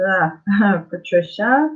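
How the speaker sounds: normal talking voice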